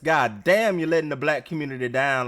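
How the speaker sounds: loud scolding rant at the TV. Real, not acted. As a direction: tear into the contestant. Echoing host, scolding contestant.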